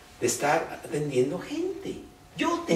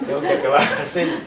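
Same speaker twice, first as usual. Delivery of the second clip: laughing mock hostess; 8 dB louder, faster than usual. Imita a la anfitriona riendo.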